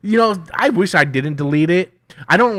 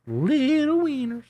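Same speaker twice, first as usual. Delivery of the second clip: silly high voice; 11 semitones higher, 5 dB quieter, slower than usual. Shown as a demonstration. Repeats the name in a silly voice.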